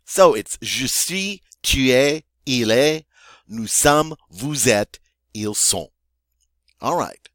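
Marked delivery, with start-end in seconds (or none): 0.6-5.9 each pair from "je suis," to "ils sont." said separately, final s silent; French conjugation recital. Shown as a demonstration. Teacher recaps how we say to be, recited in French. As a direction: recite "tu es," "il est," raised, settling by "ils sont.". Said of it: "this is how we say".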